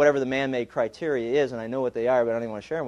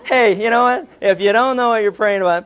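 ordinary talk

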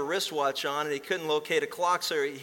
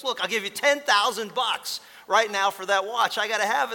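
raised, louder, pressing bid; eager pushy buyer; 6 semitones higher, 6 dB louder than usual. Retold or imitated. Stranger at the airport desperately bidding for the inventor's watch, loud and eager. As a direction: push hard, you must own that watch.

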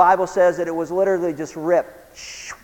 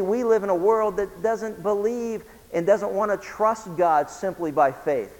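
usual speaking voice

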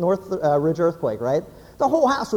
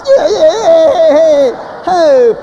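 very loud celebratory whooping; rowdy cheering; 4 semitones higher, 10 dB louder, slower than usual. Acted. Guys high-fiving in the car after passing a rival, rowdy. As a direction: cheer like a winning team.

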